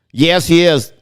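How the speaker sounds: ordinary talk, nothing marked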